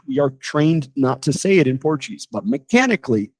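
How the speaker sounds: ordinary delivery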